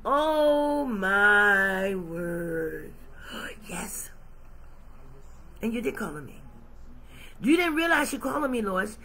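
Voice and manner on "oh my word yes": hushed gasp of wonder; awed whisper. Acted. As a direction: gasp then a breathless whispered yes. Awed reaction to God.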